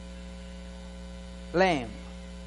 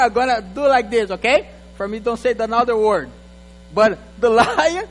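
ordinary talk

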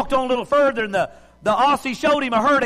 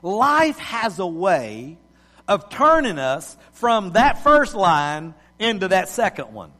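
preaching; 3 semitones lower than usual. Acted. Preacher opening sermon.

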